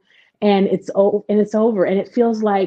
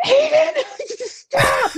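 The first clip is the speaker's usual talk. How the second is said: startled yell; much slower than usual. Retold or imitated. Startled mother snapping at son, high laughing yell. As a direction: yell at her son when juice hits her eye.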